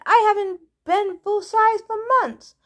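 high-pitched pleading snake lady. A narrator's reading. Martha the snake begging to change size, pleading. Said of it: "Martha pleaded".

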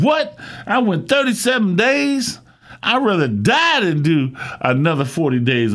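high, incredulous outburst; surprised. Retold or imitated. Shocked reply to God.

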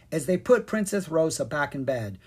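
plain reading voice

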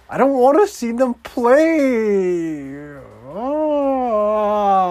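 high, slow, strained groan; exasperated whine. Real, not acted. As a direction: moan in frustration. Exasperated at the game.

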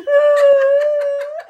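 high shrieking laugh-cry; cry-laugh loud and high. Real, not acted. Laugh-cry after retold quote.

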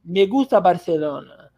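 light Spanish accent with a lisp. Shown as a demonstration. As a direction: say it slowly like a Spaniard. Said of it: "língua sibilante presa".

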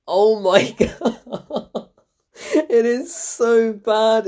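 very slow, drawn out through laughing; helpless laughter. Real, not acted. Critic on a bad British accent, laughing helplessly. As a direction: barely get it out for laughing.

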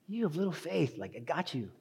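soft, reassuring voice; gentle Jesus. Acted. Jesus catching sinking Peter, reassuring. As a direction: comfort him quietly as you catch him. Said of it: "that's how Jesus would say it".

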